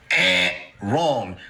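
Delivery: low, mock buzzer voice; game show buzzer. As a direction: buzz him out like a wrong answer. Buzzer reply to bad plan.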